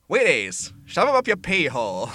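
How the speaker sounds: put-on loud old-timey announcer. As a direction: bellow it like an old vaudeville barker. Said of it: "the old-timey voice".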